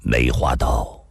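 deep, sombre tone; low and grave. A narrator's reading. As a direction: drop the voice low and serious. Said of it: "沉声地说道".

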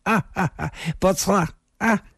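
high, spaced-out acted laugh. A narrator's reading. Woman playing along with the wardrobe joke, laughing. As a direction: laugh lightly, then agree in a high voice. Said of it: "那女子也笑了".

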